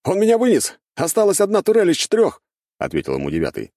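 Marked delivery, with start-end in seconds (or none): 0.0-2.4 high, loud, urgent report; battle shout. A narrator's reading. Gunner reporting losses to his crew in battle, urgent shout. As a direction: shout the damage report over gunfire.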